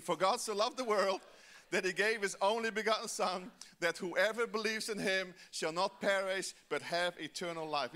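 loud, steady unison recital; congregational recitation. Shown as a demonstration. Pastor reciting John three sixteen with the church, loud. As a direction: say it all together from memory. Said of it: "Let's do it again".

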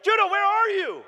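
high, loud panicked yelling; frantic shout. Retold or imitated. Father searching frantically for missing son, shouting. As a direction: scream for him across the yard.

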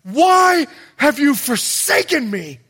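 loud, high cry of anguish. Acted. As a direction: cry it out from the cross, forsaken. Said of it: "Jesus on the cross cries out".

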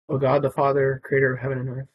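solemn call to prayer; prayer leader. Acted. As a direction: lead the litany, loud and solemn. Leads the litany.

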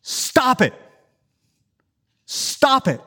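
stern self-command. Pretend. Preacher telling his fearful heart to stop. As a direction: snap at your own fearful heart. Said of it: "you say to your own fearful heart".

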